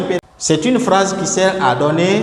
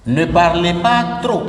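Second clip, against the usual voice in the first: firm command. Shown as a demonstration. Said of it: "un exemple de phrase, je vous donne ceci".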